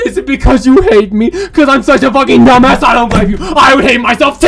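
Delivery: loud, wailing mock heartbreak; hammed-up anguished shouting; melodramatic pleading. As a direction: beg a lover not to leave, at full volume. Acted plea to departing darling.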